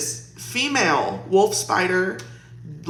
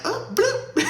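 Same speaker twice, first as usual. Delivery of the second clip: a high, offhand bloop; nonchalant bloop; 3 dB quieter than usual. Retold or imitated. Mimicking her husband flicking the spider away, high nonchalant bloop. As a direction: toss off a high little bloop. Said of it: "he's just like".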